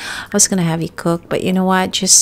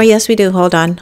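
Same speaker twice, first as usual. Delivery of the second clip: usual speaking voice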